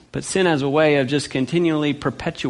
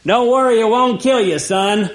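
dad yelling; 6 semitones higher than usual. Retold or imitated. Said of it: "Dad yells out from the back".

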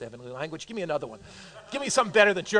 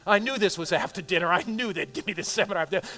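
ordinary talk

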